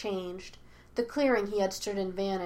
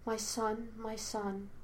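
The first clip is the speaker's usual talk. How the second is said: murmuring; 9 dB quieter, slower than usual. A narrator's reading. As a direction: murmur it tenderly, close and quiet. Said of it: "She kept murmuring in his ear".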